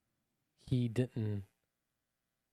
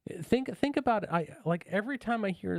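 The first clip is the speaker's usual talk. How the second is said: excited; 8 semitones higher than usual. Real, not acted. Passionate argument.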